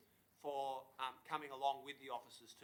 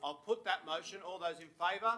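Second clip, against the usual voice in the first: loud, raised voice over the alarm; shouting over fire alarm; 5 semitones higher, 9 dB louder than usual. Real, not acted. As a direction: project loudly to be heard over the noise. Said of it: "is that an actual alarm?".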